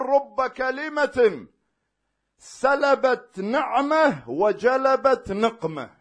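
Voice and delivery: solemn preached quote. Acted. Preacher quoting Imam Ali to believers, solemn preaching. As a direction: intone the Imam's saying word by word.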